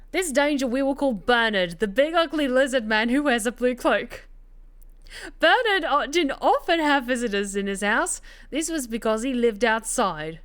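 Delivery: hammed-up fake Cockney storyteller; bad Cockney. Narrator telling Rita's forest detour on request, in bad Cockney. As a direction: spin the tale in a badly faked Cockney accent. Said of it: "To, to do the bad Cockney accent".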